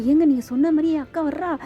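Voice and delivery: imitating a happy, excited outburst; retold joyful excitement. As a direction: voice her delight as if jumping for joy. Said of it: "அப்படியே சந்தோஷத்துல துள்ளி குதிக்கிறாங்க".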